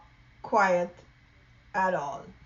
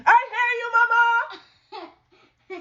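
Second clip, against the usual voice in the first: loud, high little mouse yell; very loudly; 9 dB louder, slower than usual. Acted. Loud Mouse answering mama very loudly, high and loud. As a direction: belt it out like a noisy child. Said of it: "very loudly".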